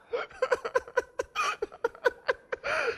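light, high chuckle; amused preacher chuckles; soft high laugh. Real, not acted. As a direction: laugh quietly to yourself. Laughs imagining the scene.

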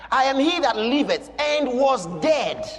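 loud, high shouted proclamation; preaching at full force. Acted. Preacher shouting Jesus' words to John, loud and high. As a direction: thunder it out to the church.

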